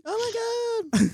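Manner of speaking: mock squeal. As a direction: drag it out like a giddy girlfriend. Co-host mocks the friends.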